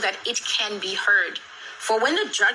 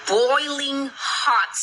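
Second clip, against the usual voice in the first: drawn-out emphasis; 6 semitones higher, much slower than usual. Real, not acted. Describing the scorching sealed room.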